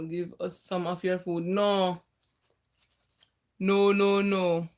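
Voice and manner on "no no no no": usual speaking voice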